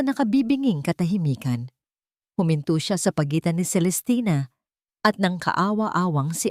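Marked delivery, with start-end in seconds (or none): none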